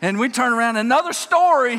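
normal talking voice

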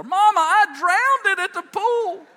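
slow, very high mock-child yell; child hollering. Boy telling Mama the moment they hit the door, imitated wailing holler. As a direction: bellow it like a tattling little boy. Boy blurts secret to mother.

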